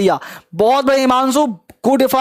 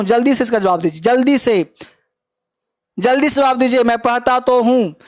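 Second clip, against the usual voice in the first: ordinary talk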